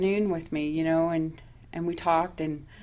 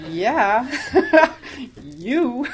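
laughing disbelief; incredulous laughing; 6 semitones higher, 6 dB louder than usual. Retold or imitated. Teller mocks the sponsor question, laughing in disbelief. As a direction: laugh through the yeah and you.

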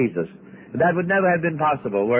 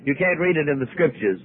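ordinary talk

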